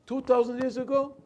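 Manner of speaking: high, loud disbelief; can't believe what he just heard; incredulous. Retold or imitated. Napoleon incredulous at mourning.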